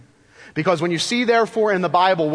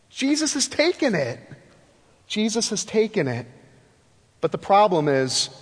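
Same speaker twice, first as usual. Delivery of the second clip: usual speaking voice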